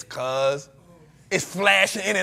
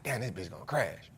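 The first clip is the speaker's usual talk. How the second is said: low, much softer muttered fear; muttered dread; 11 semitones lower, 15 dB quieter than usual. Retold or imitated. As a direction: mutter it under your breath as if the plane is going down. Retold fear of plane crashing.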